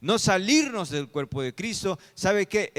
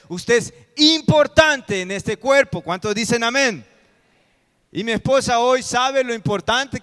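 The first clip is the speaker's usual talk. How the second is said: loud, raised-pitch pulpit exhortation; impassioned preaching; 6 semitones higher, 9 dB louder than usual. Real, not acted. Pastor tells each member they are important, impassioned preaching. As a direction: drive it home to the whole congregation.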